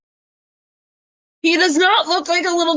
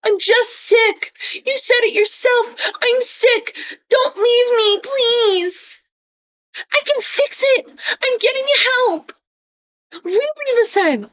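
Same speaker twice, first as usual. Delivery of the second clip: pleading mock cry, pitched way up; mock whiny Isaac; 8 semitones higher than usual. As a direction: ham up a needy friend begging not to be left. Reading Isaac's pleading messages aloud.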